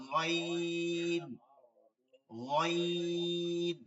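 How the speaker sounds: গইন drawn long with the গ rubbing at the back of the throat; slow throaty ghayn. Shown as a demonstration. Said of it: "তালুতে ঘষা খাবে".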